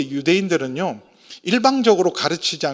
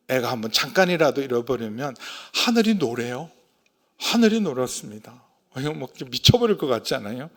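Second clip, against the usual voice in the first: ordinary talk, nothing marked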